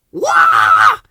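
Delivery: loud fearful yelp; startled loud scared cry; terrified yell. Acted. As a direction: yelp as if jumping with fright. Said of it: "yelled Ben ... terrified".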